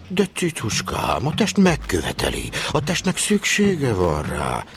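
high, slow, drawn-out cooing; mock seducer. Trainee mimicking the dispatcher wooing a lady, mock seducer. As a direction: coo it like a coaxing lover.

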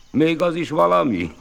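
high, loud scoffing cry; mocking shout. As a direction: laugh him off loudly. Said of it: "kiáltotta". (gúnyos kiáltás)

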